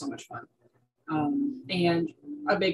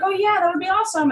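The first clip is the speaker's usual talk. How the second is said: much higher, louder, gushing imitation; excited reply; 13 semitones higher, 8 dB louder, faster than usual. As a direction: gush back like a thrilled owner. Retelling an owner's eager reply.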